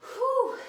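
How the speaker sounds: high, loud whew of real exertion; big exhaled whew. Real, not acted. Trainer catching breath after the plank, big loud whew. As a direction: let out a hard, relieved whew.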